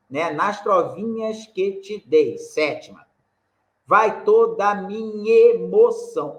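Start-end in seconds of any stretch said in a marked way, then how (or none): 0.3-2.4 "Nas trovinhas que te dei" slow and syllable by syllable, weight on dei; scanning syllables. Shown as a demonstration. Teacher counting syllables of the verse aloud, slow scansion. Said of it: "a sílaba tônica tá no dei". 3.9-6.4 "Vai toda a minha emoção" slow, louder, syllables apart; scanning syllables. Shown as a demonstration. Teacher scanning the verse for students, slow and deliberate. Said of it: "olha só".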